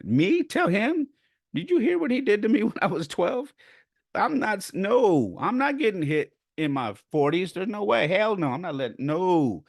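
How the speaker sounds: high, hammed-up refusal; incredulous protest. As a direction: squeal in disbelief, then refuse point-blank. Acted refusal to confront grandfather.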